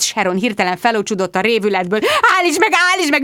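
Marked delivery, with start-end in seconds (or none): 2.4-3.2 high, panicked shriek. A narrator's reading. Sharon at the machine, shrieking high. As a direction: scream to make it stop. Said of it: "visította".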